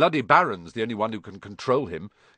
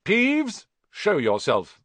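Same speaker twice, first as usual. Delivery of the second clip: raised voice; 8 semitones higher, 4 dB louder, slower than usual. A narrator's reading. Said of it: "He raised his voice".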